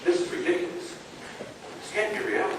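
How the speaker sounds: usual speaking voice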